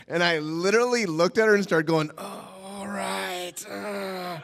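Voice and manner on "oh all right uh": soft, slow, stretched-out mock groan; awkward drawn-out assent. Teen asked to roll joints, soft awkward assent. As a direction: drag the words out very softly.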